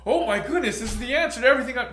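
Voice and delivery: high, loud, fast rush of wonder; gushing excitement. Retold or imitated. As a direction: blurt it out overwhelmed. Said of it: "It's like".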